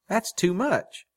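high-pitched protest at the offer; surprised protest. A narrator's reading. Woman refusing his offered money, surprised protest.

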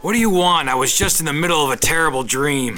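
loud, annoyed at the door; grumpy, just woken. Acted. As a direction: snap at whoever woke him.

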